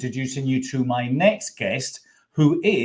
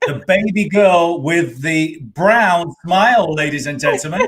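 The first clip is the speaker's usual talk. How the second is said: showman introduction; 5 semitones higher, 6 dB louder, slower than usual. Real, not acted. Host introduces guest with flourish.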